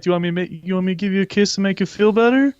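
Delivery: mocking a creep; creepy fast offer. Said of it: "And he's just like".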